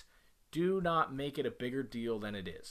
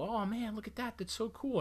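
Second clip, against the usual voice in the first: put-on much higher, delighted kid-praise voice; cheerful praise; 9 semitones higher than usual. Parent praising son playing nicely, bright high praise. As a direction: gush over the little car. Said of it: "I'll come over and go".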